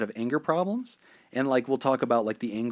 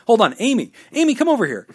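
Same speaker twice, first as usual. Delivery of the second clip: much higher and louder, calling a colleague over; calling across the lot; 9 semitones higher, 8 dB louder, faster than usual. Retold or imitated. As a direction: shout for Amy across the car lot. Salesman calls Amy across the lot.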